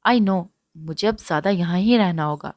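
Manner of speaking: ordinary delivery, nothing marked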